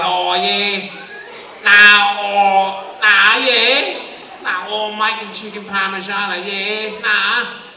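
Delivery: exaggerated Aussie bogan chef; broad Aussie bogan. Aussie chef cooking in France, broad bogan accent. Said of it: "you're never gonna get an Aussie chef".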